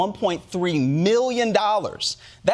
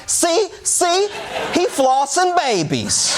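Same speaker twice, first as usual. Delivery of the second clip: accusing conspiracy believers; 11 semitones higher, slower than usual. Acted. QAnon believers spotting something between your teeth, high mock accusation. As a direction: cry it out like a triumphant accuser. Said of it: "they're like".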